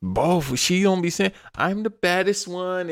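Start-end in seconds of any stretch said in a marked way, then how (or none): 1.5-2.9 high, mocking imitation of a boast. Host mocking the wrestler's promos, dismissive imitation. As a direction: mimic her dismissively, pitched up.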